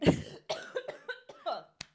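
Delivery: a short real cough; coughing fit. Real, not acted. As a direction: clear the throat with a cough. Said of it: "Sorry, I'm coughing".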